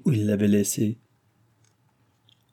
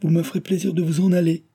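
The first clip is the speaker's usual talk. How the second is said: higher-pitched, impatient dismissal; 7 semitones higher, faster than usual. A narrator's reading. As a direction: send him away impatiently. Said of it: "s'écria-t-elle d'un ton d'impatience".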